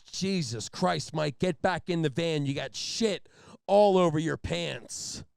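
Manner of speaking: mock exasperation, stretching the key words; pissed-off coworker. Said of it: "He's pissed off".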